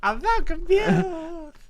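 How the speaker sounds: high, slow, mocking squeak; mock girly voice. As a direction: parrot her line in a shrill squeak. Mocking the character line.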